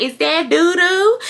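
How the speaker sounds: high, slow mock country accent; drawn-out country-accent mimicry. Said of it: "had that country accent".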